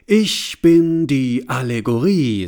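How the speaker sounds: loud, slow and self-important; pompous declaration. Acted. Allegory introduces itself to the bowing crowd.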